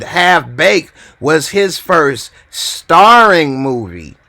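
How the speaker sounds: "starring" stretched out, high and loud; stress on starring. Real, not acted. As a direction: punch the word "starring" slowly. Contrasting starring role with appearance.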